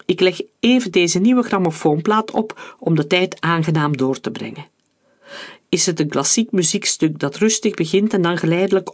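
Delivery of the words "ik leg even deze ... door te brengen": ordinary delivery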